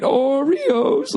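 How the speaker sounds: very high, drawn-out mocking singsong; stretched, high-pitched jeer; mocking taunt. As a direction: imitate someone sneering at you in song. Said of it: "sounded like he was shitting on me the way he went".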